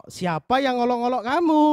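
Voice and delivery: ordinary talk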